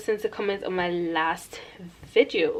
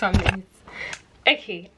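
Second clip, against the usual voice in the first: ordinary talk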